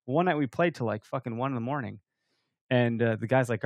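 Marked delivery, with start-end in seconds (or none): none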